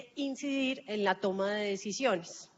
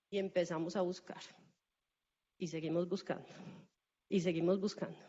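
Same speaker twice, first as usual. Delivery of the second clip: low, subdued, repetitive storytelling; 4 semitones lower, 5 dB quieter, faster than usual. Retold or imitated. Speaker telling audience of the long search for a communicator, mildly weary. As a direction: drop the pitch, as if the task drags on.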